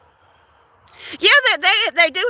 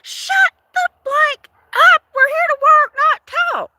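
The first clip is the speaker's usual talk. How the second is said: mock scream. Wanting to yell at chatty coworkers, high fed-up shout. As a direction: shout them down to shut up. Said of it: "I just wanted to just scream".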